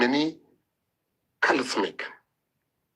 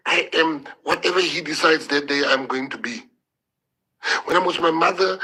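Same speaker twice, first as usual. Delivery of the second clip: ordinary delivery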